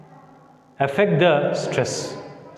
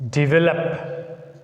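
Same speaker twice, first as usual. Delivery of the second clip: develop slowly, stress on VE, rising; slow model word; much slower than usual. Shown as a demonstration. Teacher slowly models develop for the class. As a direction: stretch develop out, de-VE-lop. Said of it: "Develop is a verb".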